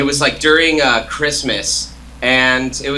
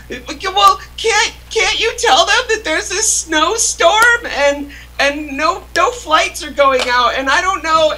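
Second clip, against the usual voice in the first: much higher imitation of his mom pleading through tears; crying mom; slower than usual. Son imitating his mom begging him not to go, high tearful plea. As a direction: squeak out the plea like a weeping mother. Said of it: "bursting out in tears".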